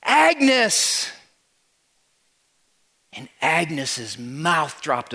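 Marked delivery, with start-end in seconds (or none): 0.0-1.1 much higher, louder, drawn-out happy shout; screamed greeting. Acted. Crowd surprising Agnes at the diner, joyful scream. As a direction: belt it like a surprise party crowd. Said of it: "everybody screamed".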